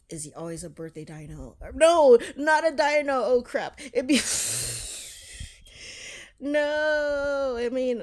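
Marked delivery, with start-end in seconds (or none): 1.7-4.2 loud, high outburst at a slip; exclaimed self-correction. Real, not acted. Vlogger catches themself calling it a dino, exclaimed dismay. 6.4-8.0 long, high, loud cry of dismay; dismayed exclamation. Real, not acted. Vlogger realizing the dino slip, loud dismayed cry. Exclaims over own mistake.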